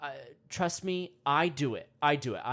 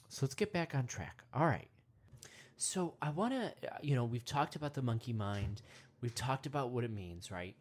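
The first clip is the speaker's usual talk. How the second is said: guided meditation; 5 semitones lower, 6 dB quieter than usual. Acted. Calm meditation intro.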